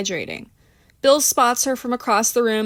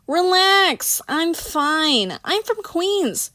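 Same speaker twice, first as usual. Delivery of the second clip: much higher, breezy tipsy swagger; tipsy brush-off; 8 semitones higher, much slower than usual. Acted. Tipsy Christina brushing off worried Bill, high and breezy. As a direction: wave him off, cocky and airy.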